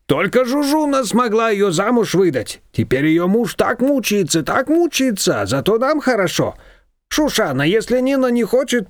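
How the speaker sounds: a chatty confectioner telling tales; confectioner's voice. A narrator's reading.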